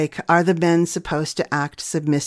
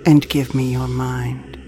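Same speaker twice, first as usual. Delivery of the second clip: teasing villain voice; 3 semitones lower, 3 dB louder than usual. Acted. Promo tag in villain voice.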